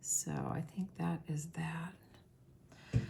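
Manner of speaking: whispering. Real, not acted. Wrapping up a segment.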